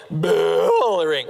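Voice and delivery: word stretched long into a cow's moo; comic imitation of a bellowing cow. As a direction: bellow it slowly like a cow, drawing out the first syllable. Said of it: "Like, that's a cow".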